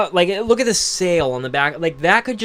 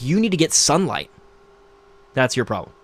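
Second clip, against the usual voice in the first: ordinary delivery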